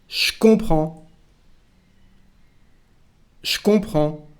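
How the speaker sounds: "je comprends" said shkon-pran; je becomes sh. Shown as a demonstration. Teacher modelling the contraction, "je comprends" said as shkon-pran. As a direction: slide sh straight into "comprends". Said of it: "It is with the sound ch".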